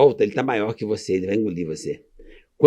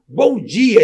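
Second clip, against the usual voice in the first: higher, louder Bom dia called out; retold hearty bom dia; cheerful greeting; 8 semitones higher, 6 dB louder than usual. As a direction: proudly greet the waiting staff. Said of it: "Eu falei assim".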